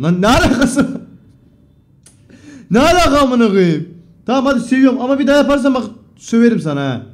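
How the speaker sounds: laughing outburst. Real, not acted. Streamer laughing loudly at a viewer's unban request. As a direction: burst out laughing at the absurd message.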